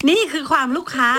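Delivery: mock client voice. Mimicking a fussy client.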